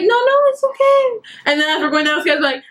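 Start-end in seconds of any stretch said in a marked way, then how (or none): 0.0-1.1 very high, squeaky fangirl reassurance; gushing reassurance. Retold or imitated. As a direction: pitch it way up, rushing to reassure him. Retold reassurance to apologizing singer.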